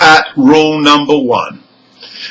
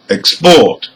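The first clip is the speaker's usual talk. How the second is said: "export" stressed on PORT, as a verb; last-syllable stress. Shown as a demonstration. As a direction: punch the end of "export". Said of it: "have stress on the".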